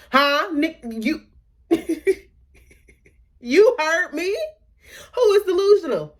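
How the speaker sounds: high, squeaky, loud play-dumb voice, dragged out; mock high caught-out boyfriend. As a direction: act like a guilty boyfriend pretending not to hear. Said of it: "And he like".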